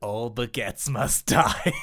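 slow, low dramatic title read; drawn-out trailer voice; mock show title. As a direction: announce it like a movie trailer.

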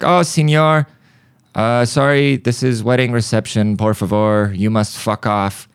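a hint of Spanish-accented politeness; light Mexican accent. Guest throwing him out of the reception, light Mexican accent.

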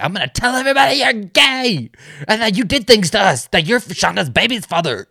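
mock hysterical Misty voice; frantic Misty. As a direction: play her blurting wild threats in a rush. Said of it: "And she's like".

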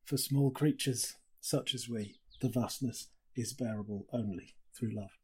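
hushed, awed reading of a passage; reading aloud. Shown as a demonstration. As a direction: let the words settle, quiet and moved. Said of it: "just wanted to read to you".

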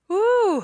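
shaky laughing exhale through tears; shaky sigh. Real, not acted. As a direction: let out a wobbly, tearful woo. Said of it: "I'm trying not to cry through this".

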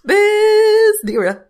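high, slow, stretched-out name; drawn-out name. Pretend. Player told to say her name once, drawing it out slowly. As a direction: savour every syllable of the name. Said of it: "You can say your name once".

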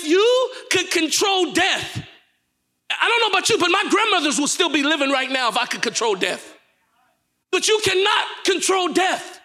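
high, impassioned sermon shout; full-force preaching. Real, not acted. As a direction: drive it home to the congregation, pitched high.

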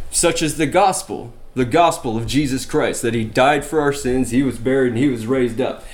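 normal talking voice

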